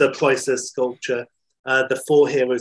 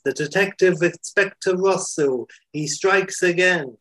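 higher-pitched dramatic police captain; Italian police captain; 6 semitones higher than usual. Acted. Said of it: "And the Italian policeman, um, you know, like, the captain".

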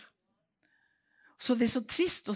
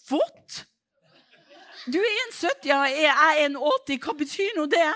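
loud, much higher, indignant; outraged retort; 7 semitones higher, 8 dB louder than usual. Retold or imitated. To her sister in the car, loud indignant retort. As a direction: fire it back in disbelief.

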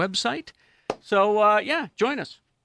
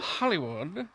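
quiet, slightly questioning echo; understated echo; 6 dB quieter, slower than usual. Pretend. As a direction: say it lightly under the breath. Echoes Hollywood, then calls it out.

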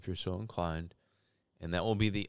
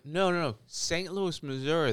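exasperated correction; 9 semitones higher than usual. Retold or imitated. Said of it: "And I was like".

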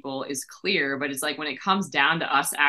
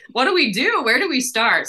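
flustered questions; 8 semitones higher, 6 dB louder than usual. Retold or imitated. Retelling feeling of being lost.

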